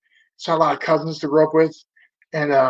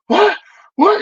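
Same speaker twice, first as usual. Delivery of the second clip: sharp, much higher, louder double what; shocked what; 5 dB louder than usual. Retold or imitated. Seeing a guy punched at a show, shocked higher cry. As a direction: blurt it out in disbelief. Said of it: "I'm like".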